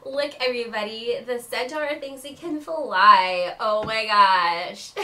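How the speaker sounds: loud, sneering taunt, slow at the end; mocking school girl. Aurelia taunts the centaur before everybody, loud and sneering. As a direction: jeer at the centaur for the crowd. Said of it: "She goes".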